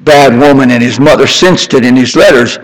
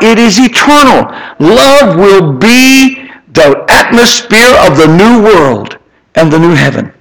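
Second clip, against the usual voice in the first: preaching climax; 4 semitones higher than usual. Acted. Preacher proclaiming God's eternal love, rising fervor. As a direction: build it up to the heavens.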